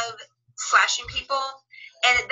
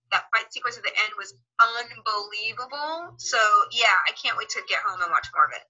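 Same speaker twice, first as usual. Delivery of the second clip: normal talking voice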